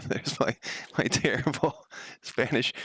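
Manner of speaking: real chuckle breaking up the words; laughing at oneself. Real, not acted. Streamer laughs at own bad Spanish, chuckling through the words.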